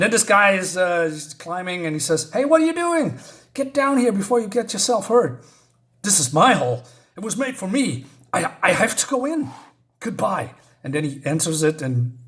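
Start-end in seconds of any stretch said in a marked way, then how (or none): 2.3-5.4 high, loud warning shout; calling out. Retold or imitated. Onlooker warns a climbing man, urgent high call. 6.0-10.5 high-pitched compelled protest; acted desperate insistence. Climber refuses to come down, high acted insistence.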